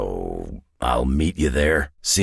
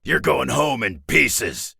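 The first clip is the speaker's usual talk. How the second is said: menacing taunt; 10 semitones higher, slower than usual. Acted. Battle taunt to an enemy.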